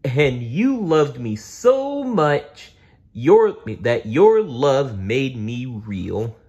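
slow, cartoon dinosaur voice; tender Barney. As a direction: tell a child she's loved.

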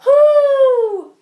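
high loud drawn-out hoot; owl hoot. Acted. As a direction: let out one long owl hoot. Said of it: "hooted like an owl".

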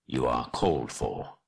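low, grave summons. Acted. Gandalf calling the hobbit into the tent, grave and low. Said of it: "he said more gravely".

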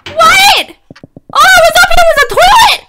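high, loud panicked outburst; loud, startled squeal of disbelief; startled freak-out. Real, not acted. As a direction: yelp it in high-pitched shock.

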